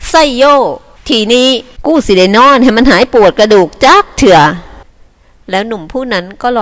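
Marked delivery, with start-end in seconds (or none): 0.1-4.4 calling out louder and higher. Acted. Young herder shouting happily to his friends. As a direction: whoop it out for the whole camp. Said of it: "พูดดังๆว่า".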